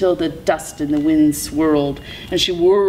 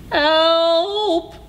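long, high howl for help; 12 semitones higher than usual. A narrator's reading. Coyote can run no further, howling. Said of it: "he howled".

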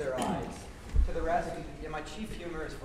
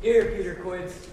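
booming answer; 3 semitones higher, 11 dB louder, slower than usual. Acted. Bottom shouting roll call.

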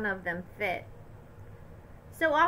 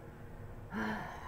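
long breathy sigh; tired sigh; 12 dB quieter than usual. A narrator's reading. Dad sighing after the Santa line. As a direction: let out a weary sigh.

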